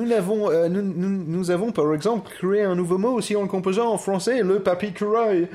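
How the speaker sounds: put-on French with an English accent. Arguing French can coin words too, English accent.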